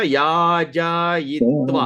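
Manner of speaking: long, evenly held syllables; slow pronunciation. Shown as a demonstration. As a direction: draw each syllable out for learners. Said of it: "when you pronounce, you say it, uh, slowly".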